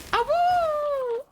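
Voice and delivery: high howling awoo; wolf howl. Acted. Streamer howling at viewer.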